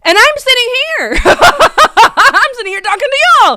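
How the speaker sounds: loud, high wail breaking into laughter; excited disbelief. Real, not acted. Missed star while streaming.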